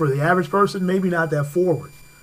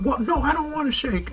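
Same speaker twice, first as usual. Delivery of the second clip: much higher, mock indignant driver; hammed-up snippy lady refusing; mimicking angry driver; 11 semitones higher than usual. Mocking the angry driver for the host, higher mock voice. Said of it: "And she's like".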